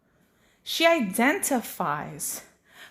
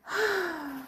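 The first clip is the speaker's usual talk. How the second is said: imitated high, soft little sigh; toddler's sigh; 7 semitones higher, 7 dB quieter than usual. Mom imitating her one-year-old, high breathy sigh. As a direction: let out a small airy sigh, imitating a toddler. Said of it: "and she sighs".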